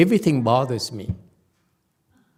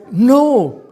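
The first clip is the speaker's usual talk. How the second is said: louder and higher, venting that everything is not okay; half-joking, worked-up protest, voice raised; loud exasperated outburst; 8 semitones higher, 7 dB louder than usual. Retold or imitated. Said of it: "And I said".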